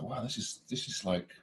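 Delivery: soft, understated wonder; quietly amazed, retold; hushed awe. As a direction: recall being awestruck, voice dropped right down. Retelling awe on the closed set.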